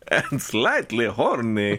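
slow, drawn-out fake Italian Dracula; silly Italian-accented vampire; bad Italian accent. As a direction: ham it up in mock Italian. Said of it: "like, a really bad Italian accent".

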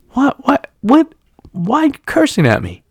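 high-pitched, stammering mock bewilderment; baffled protest. Older delivery man answering the driver, baffled protest. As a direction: imitate a man startled at being cursed.

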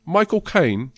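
high, loud Cockney pronunciation; Michael Caine impression. Shown as a demonstration. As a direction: say the name in exaggerated Cockney. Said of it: "if you say it like he does".

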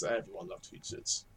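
breathy whispered aside. Acted. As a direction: whisper it under your breath. Third repeat, whispered trailing.

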